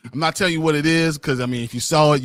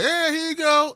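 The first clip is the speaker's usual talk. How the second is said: much higher, breezy mock voice; mock careless handover. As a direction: toss it off like a clueless fool. Mocking careless character handing over.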